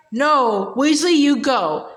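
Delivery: plain reading voice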